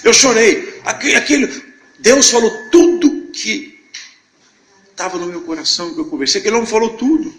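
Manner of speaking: high, loud, choked-up testimony; overcome with emotion. Real, not acted. Testifying that God knew his heart, overcome. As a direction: cry it out, voice straining high with emotion. Recalls crying when God spoke.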